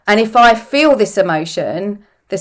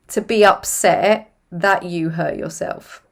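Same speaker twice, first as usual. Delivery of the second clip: ordinary delivery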